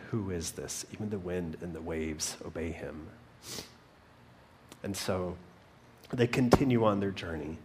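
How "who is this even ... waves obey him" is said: hushed, lower retold question; awed fear. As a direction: ask it softly, in understated awe. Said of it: "They were terrified".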